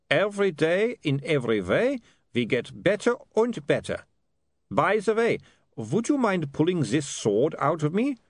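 a hint of a breezy vampire; cheerful vampire. Vampire Otto to William, lightly voiced and breezy. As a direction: chat lightly with a sword in you.

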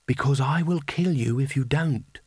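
slow, lightly voiced alluring stranger; seductive woman's voice. A narrator's reading. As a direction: draw the urgent words out invitingly. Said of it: "a voice that could make "good morning" sound like an invitation".

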